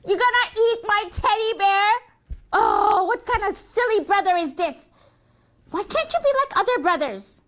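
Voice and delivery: high-pitched, indignant whine; exasperated scolding. Acted. Sister scolding her silly baby brother, exasperated. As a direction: complain high in disbelief.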